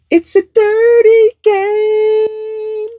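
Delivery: very high, slow sign-off cry; drawn-out catchphrase holler. Acted. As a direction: stretch each word into a high, ringing call.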